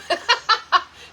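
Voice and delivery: loud burst of real laughter. Real, not acted. Laughing at her own late excuse, loud laugh.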